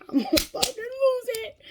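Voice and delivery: very high strained squeal; mock inner meltdown, pitch shot way up; about to snap. Mom at breaking point in her head, losing it.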